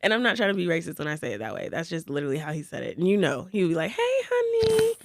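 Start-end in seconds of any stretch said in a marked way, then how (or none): 3.9-4.9 high, slow sing-song greeting; impression of coworker. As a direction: stretch out a sweet, high "hey honey". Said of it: "let me stop doing the impression".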